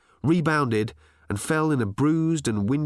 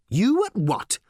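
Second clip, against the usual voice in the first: high-pitched baffled question; astonished, pitch jumping up; incredulous interruption; 11 semitones higher, 3 dB louder, slower than usual. Acted. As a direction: cut in with disbelief. Ford interrupts in disbelief.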